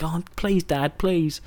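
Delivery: mock childish whine; whining kid. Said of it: "shut me up from whining".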